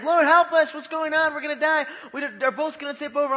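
loud, high-pitched mock panic; freaking out. Disciples in a storm crying to Jesus, freaking out. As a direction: act out panicked cries for help. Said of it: "The disciples were freaking out".

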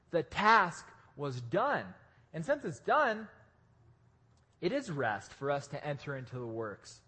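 ordinary delivery

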